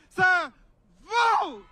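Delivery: high, loud shout to a crowd; full rallying shout. Shown as a demonstration. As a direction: shout it so it carries to Washington. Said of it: "so loud".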